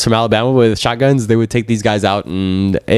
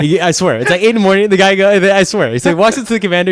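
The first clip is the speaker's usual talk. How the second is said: laughing while telling; 6 semitones higher than usual. Real, not acted.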